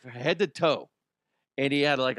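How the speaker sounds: normal talking voice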